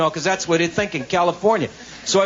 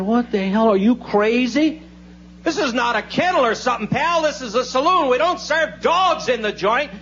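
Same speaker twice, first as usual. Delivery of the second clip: raised pitch, mock outrage; indignant bartender; 6 semitones higher than usual. Bartender refuses to serve a dog, mock indignation. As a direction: scold in a high, put-upon voice.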